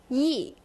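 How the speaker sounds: "yi" short and sharply falling; sharp fourth tone. Shown as a demonstration. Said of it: "You kinda say it sharply".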